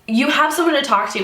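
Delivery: usual speaking voice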